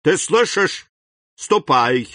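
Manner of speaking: строгий приказ, выше и громче; прогони мальчика строго; stern order. A narrator's reading. Father orders son away. (отец строго приказывает сыну)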